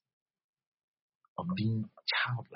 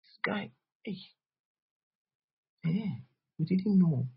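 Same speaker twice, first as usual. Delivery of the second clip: surprised onlooker; 6 semitones higher than usual. Retold or imitated. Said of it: "somebody sees you ... and say".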